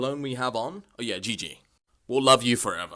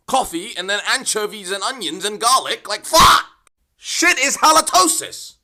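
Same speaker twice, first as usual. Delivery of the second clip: shouted, rising to a higher, louder yell; disgusted rant; 7 semitones higher, 7 dB louder than usual. Acted. Vlogger ranting about a teacher's bad breath, shouting in disgust. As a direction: blow up in mock disgust at the stench.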